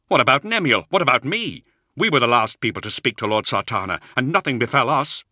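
protesting. A narrator's reading. Zahariel arguing nothing befell him or Nemiel, protesting. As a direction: throw out each counterexample, high and loud. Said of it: "he protested".

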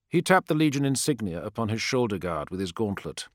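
plain reading voice

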